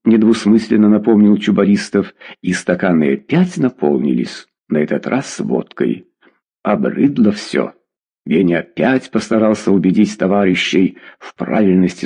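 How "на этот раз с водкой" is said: plain reading voice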